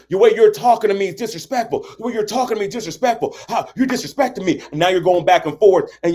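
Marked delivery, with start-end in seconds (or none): 0.1-4.6 high-pitched, indignant bickering; acted angry complaint. Partner mad at the other person, mock indignant. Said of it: "we wanna get mad at the other person".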